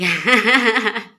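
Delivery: loud amused laugh. Real, not acted. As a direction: laugh at the joke. Laughs about noisy old people. (natatawang malakas)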